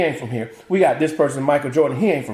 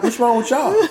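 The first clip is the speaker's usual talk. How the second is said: higher, pushing back; he answers his family, higher and indignant; mock indignation; 8 semitones higher than usual. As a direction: throw the question right back. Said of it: "I'm like".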